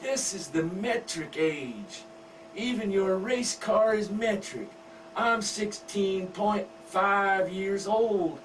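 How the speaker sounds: put-on high, coy teen girl voice; giggly daughter. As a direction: wheedle Daddy sweetly. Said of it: "smiles and giggles and says".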